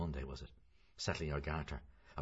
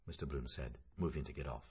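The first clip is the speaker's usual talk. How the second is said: ordinary delivery, nothing marked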